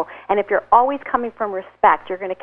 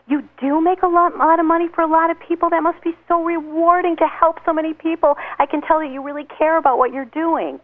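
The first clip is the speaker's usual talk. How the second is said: higher, gushing compliments; admiring; 7 semitones higher than usual. Retold or imitated. Praising a bragging guy at a party, high admiring gush. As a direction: pump him up with admiration.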